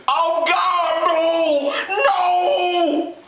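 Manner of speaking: very high, drawn-out horrified cry; anguished scream. A narrator's reading. Father seeing the truth, screaming in horror. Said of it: "he screamed".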